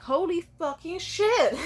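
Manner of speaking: high, loud, slow amazed curse; mom's stunned swearing. Retold or imitated. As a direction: drag out the shocked curse. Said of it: "She was like".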